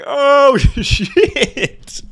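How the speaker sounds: retelling his shock at seeing her on the ground; panicked shock. Retold or imitated. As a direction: hit it like you just realized what you did. Sees the woman on ground.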